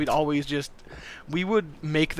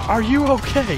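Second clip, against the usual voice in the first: high retold yell; raised, high-pitched check on a friend; 8 semitones higher, 4 dB louder than usual. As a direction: yell to get through to him. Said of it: "We're just yelling at him".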